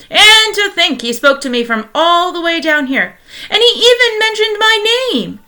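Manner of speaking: high, loud, delighted wonder; thrilled. Acted. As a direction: marvel out loud. Husband thrilled by radio mention.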